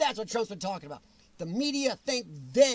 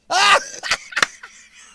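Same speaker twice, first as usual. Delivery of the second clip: loud laugh; 10 semitones higher, 12 dB louder than usual. Real, not acted. Reacting to a screenshot.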